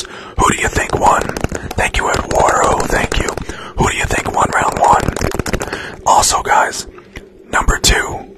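usual speaking voice

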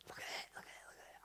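excited little whisper, barely any voice. Retold or imitated. Son nudging dad during service, eager whisper. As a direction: hush it like a child tugging dad's sleeve mid-service.

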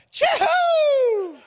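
loud, very high, long chee-hoo call; Pacific Islander whoop. Retold or imitated. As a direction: let rip a celebratory island-style holler. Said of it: "the Pacific Islanders. That's that noise".

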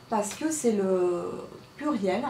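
usual speaking voice

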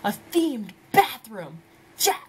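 retold gleeful outburst; super excited. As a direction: celebrate it with punchy, high yeses. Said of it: "I'm super excited. I was like".

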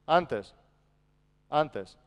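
normal talking voice

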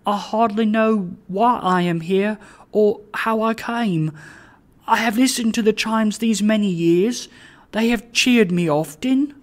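high-pitched, earnest pleading voice; humble, supplicating Trotty. A narrator's reading. Trotty explains himself to the bell, humbly earnest.

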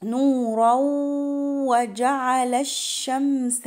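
"نورا" flowing into "وجعل" on a held nasal glide; merging with ghunnah. Shown as a demonstration. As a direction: melt the n of "نورا" into a long nasal و. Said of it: "إدغام بغنة".

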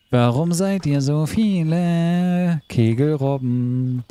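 very slow, stretched sing-song; drawn-out question. Pretend. Gamer teasing the seals, slow and stretched. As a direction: stretch every word to the seals.

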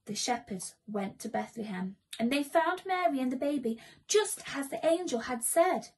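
ordinary delivery

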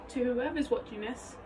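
ordinary delivery

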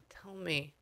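very soft, private prompt; barely voiced, as if to an unseen listener; murmured aside. Real, not acted. Medium privately prompts the spirit.

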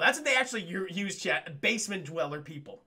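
usual speaking voice

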